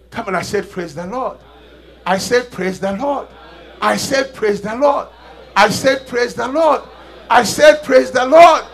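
starts firm, climbs to a loud high shout; building call and response. Real, not acted. Pastor urging the congregation, building to a shout.

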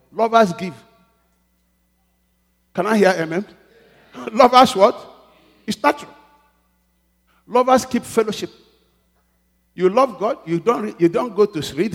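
loud, high rousing preacher call; rally the church; preaching. Acted. Preacher urging the congregation that lovers give, loud.

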